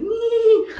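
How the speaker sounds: very high, loud nee; piercing awkward squeak, imitating yourself; nervous squeal. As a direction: squeal out of nerves. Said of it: "I was just so nervous".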